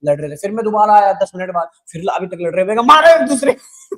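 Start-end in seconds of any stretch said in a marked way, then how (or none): 2.9-4.0 high, loud taunting shout at the brawlers; mock egging shout. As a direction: egg them on with a high, loud cheer. लड़ते कंडक्टरों को फिर उकसाना. (लड़ते कंडक्टरों को उकसाती ऊँची, तेज़ मज़ाकिया ललकार)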